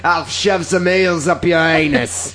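slow, drawn-out Cockney menace; hammed-up Cockney insult; mock Cockney threat. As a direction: threaten in a put-on Cockney accent.

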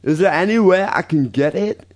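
reading an email in a put-on Russian accent; thick Yakov Smirnoff style Russian accent, slow. Host reads a fan's email in a Russian accent. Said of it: "Could you do this in a Russian accent".

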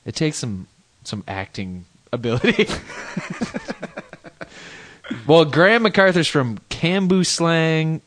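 ordinary delivery

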